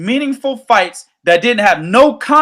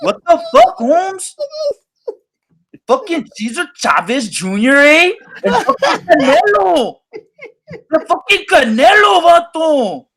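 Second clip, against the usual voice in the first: higher, hammed-up Chicano slang voice; imitating a Mexican fan in a put-on Mexican-American accent, laughing through it; teasing Mexican-American accent pitched higher; mock Mexican-American accent; 6 semitones higher than usual. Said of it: "That's how they talk".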